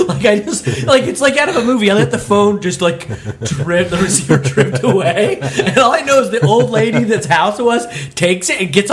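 high, animated, laughing through the words; amused, laughing retelling. Real, not acted. Driver recalling the dropped phone, laughing in disbelief. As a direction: tell it through real laughter.

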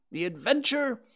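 high, measured pronouncement; grave, deliberate declaration; solemn. A narrator's reading. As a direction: pause weightily before the verdict. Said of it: "said Rabbit solemnly".

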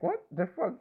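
high, incredulous exclamation; shocked outburst. Retold or imitated. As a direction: jump up in pitch, thrown by the question. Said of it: "And I'm like".

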